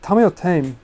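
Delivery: first "Tame" as TAH-meh, then "Tame" as taym; "Tame" tah-meh versus "Tame" rhyming with lame; two pronunciations contrasted. Shown as a demonstration. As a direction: ask with "Tame" Japanese-style, then "Tame" in English. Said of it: "How do you pronounce the name".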